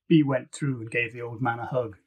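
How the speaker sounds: ordinary delivery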